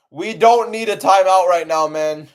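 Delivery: loud, high-pitched complaint; exasperated protest. Real, not acted. Streamer objecting to a timeout in the fight, exasperated protest. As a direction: protest loudly at the delay.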